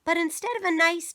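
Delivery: plain reading voice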